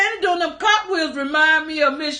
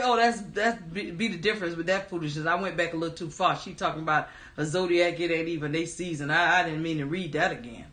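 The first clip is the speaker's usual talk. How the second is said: low, soft, rapid self-correction; quick under-the-breath explanation; muttered aside; 8 semitones lower, 7 dB quieter, faster than usual. Real, not acted. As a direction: drop right down and hurry through it. Muttered correction after misreading.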